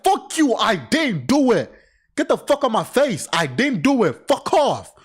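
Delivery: high, angry shouting back; snapping at the accusers; angry yell. Pretend. Imagined yelling at accusers.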